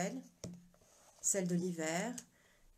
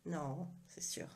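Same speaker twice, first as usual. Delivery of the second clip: knowing wink; 5 dB quieter than usual. Pretend. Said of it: "Petit clin d'œil".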